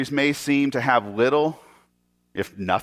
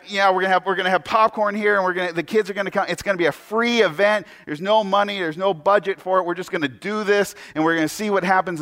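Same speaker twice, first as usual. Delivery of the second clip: higher-pitched, eager pastor pitch; excited blathering; 5 semitones higher than usual. Acted. Idiot pastor sells his free popcorn event, excited blathering. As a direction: run on with mock enthusiasm.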